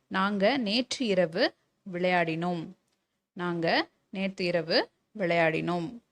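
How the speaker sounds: slow dictation. Shown as a demonstration. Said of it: "teacher dictates a model sentence".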